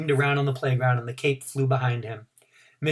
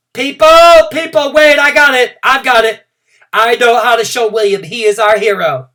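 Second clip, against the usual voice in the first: eager, much higher and louder; excited outburst; 9 semitones higher, 13 dB louder than usual. A narrator's reading. Girl stopping the class for her plan, excited. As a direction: burst in with your big idea. Girl with sudden bright idea.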